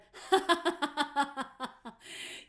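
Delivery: loud, high re-enacted cackle; dismissive laugh. Musician laughs at the fan's claim, loud mocking laugh. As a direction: burst out laughing in disbelief. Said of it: "a time that I laughed at someone".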